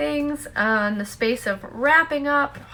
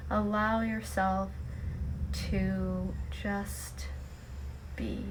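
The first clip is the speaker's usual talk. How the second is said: guided meditation; 7 dB quieter than usual. Acted. Host guiding listeners to let go and just be, guided meditation. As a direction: lead them gently into stillness, leaving long pauses.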